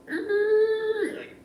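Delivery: very high, loud squealing creak; imitated nail screech. Teacher showing the noise of pulling a nail out, screechy squeal. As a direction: squeal like a nail wrenched from wood.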